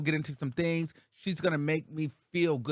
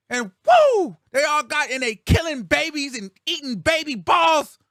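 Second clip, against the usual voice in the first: much higher, louder frenzied ranting, hammed up; 1 mock frenzied rant; 11 semitones higher, 7 dB louder than usual. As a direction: rant like an unhinged gossip host. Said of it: "[name], she doesn't".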